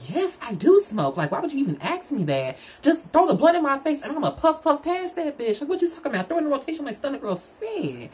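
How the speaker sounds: high-pitched, sassy retelling of her own answer; sassy comeback. Retold or imitated. Imitating herself telling her date of course she smokes, high and sassy. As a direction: throw the line back like it's obvious, a little high and playful.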